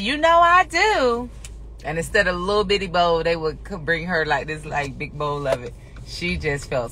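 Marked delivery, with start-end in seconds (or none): 0.0-1.3 mom's playful reply. Retold or imitated. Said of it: "She'd be like".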